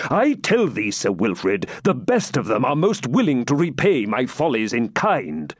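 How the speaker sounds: high, emphatic royal rebuke; testy king turning the reproach round; impatient. A narrator's reading. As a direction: argue back impatiently. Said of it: "answered Richard impatiently".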